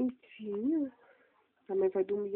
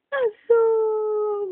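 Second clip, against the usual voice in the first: excited peekaboo; 7 semitones higher, 10 dB louder than usual. Acted. Peekaboo reveal to baby.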